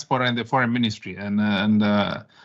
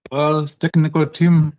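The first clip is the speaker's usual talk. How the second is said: slow, raised, high call to the tech crew; 6 semitones higher, 5 dB louder, slower than usual. Real, not acted. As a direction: call the tech team, drawn out and loud. Calling technical team to play video.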